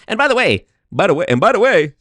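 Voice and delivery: high, insistent interjection; Patrick Bet-David. Retold or imitated. Practicing a podcaster impression, high insistent interruption. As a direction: butt in, repeating it emphatically. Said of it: "Patrick Bet-David".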